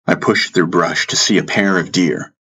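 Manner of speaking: ordinary delivery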